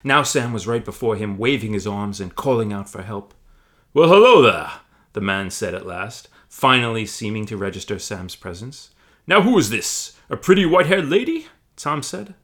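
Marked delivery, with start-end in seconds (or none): 3.9-4.8 much louder hearty giant greeting; booming Tom. Acted. 9.3-11.5 much louder hearty giant greeting; booming Tom. Acted.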